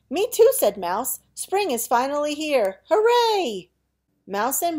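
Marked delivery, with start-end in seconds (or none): 0.1-0.5 excited squeak. Acted. Mouse eagerly agreeing. 1.3-3.7 high squeaky cheer; excited cheer. Acted. Mouse cheering spring.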